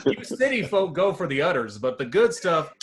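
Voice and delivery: a folksy farmer, half laughing through it; hammed-up farmer. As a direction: play the proud farmer boasting to city folk. Farmer voice continues.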